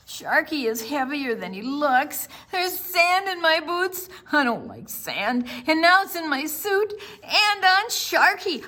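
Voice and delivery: high, loud complaining; boy complaining to dad about the hot sand; whiny child. Acted. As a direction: whine about the sand and heat.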